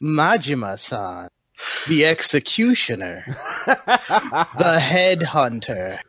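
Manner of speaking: very slow, drawn-out warning; ominous name-drop. Acted. Intervening to warn a swordsman about the executioner, ominous. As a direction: let each title land with weight. Player warns warriors with companion's reputation.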